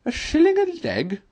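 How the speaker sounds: high, loud astonished Toad voice; Toad crying out. A narrator's reading. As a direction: Toad squawks the price in disbelief. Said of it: "cried Toad".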